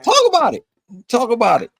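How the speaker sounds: high, loud hype call; hyped. Real, not acted. Host hyping the wrestler to talk about Nyla Rose, excited. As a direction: egg her on with a loud cheer.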